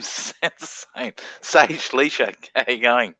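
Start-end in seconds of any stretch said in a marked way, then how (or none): 0.0-1.1 breathy laugh through the word same. Real, not acted. Streamer cracking up over the C word joke, laughing. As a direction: giggle it out unvoiced.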